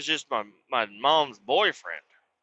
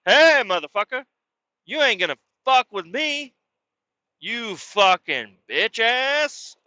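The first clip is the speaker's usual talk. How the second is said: higher, louder strutting challenge; peacock posturing yell; 7 semitones higher, 3 dB louder, slower than usual. Retold or imitated. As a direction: puff up like a big peacock and shout. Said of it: "doing my little peacock moves".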